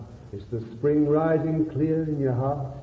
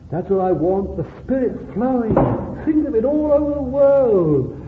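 climbing higher and louder in fervour; rising fervour; 10 semitones higher, 5 dB louder than usual. Real, not acted. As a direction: preach it up to a high, loud peak. Preacher rising to climax.

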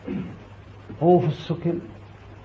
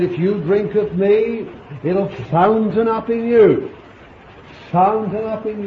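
voicing Jesus; 7 semitones higher, 3 dB louder than usual. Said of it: "And he said".

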